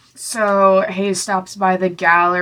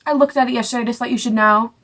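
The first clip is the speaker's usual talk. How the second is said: higher, quick, breezy hammed-up voice; casual mock; 5 semitones higher, 4 dB louder, faster than usual. Hayes telling Celine about the Malibu house, breezy high mock. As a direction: toss it off like it's nothing. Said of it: "He's like".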